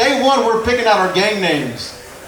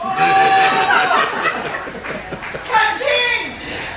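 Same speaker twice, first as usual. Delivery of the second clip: very high cackling laugh. Real, not acted. Comic laughing at his own Crocs joke, high laugh. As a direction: lose it laughing.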